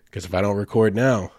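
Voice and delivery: ordinary talk, nothing marked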